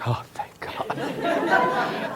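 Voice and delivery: breathy whispered relief with a laugh, very slow; mock-relieved sigh through a giggle. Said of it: "a lot of people were like".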